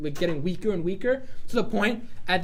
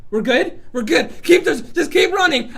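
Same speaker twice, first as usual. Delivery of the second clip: high, loud, laughing panic; frantic shout; 9 semitones higher, 8 dB louder than usual. Acted. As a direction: yell it while sprinting and laughing. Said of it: "I put everything I had into that".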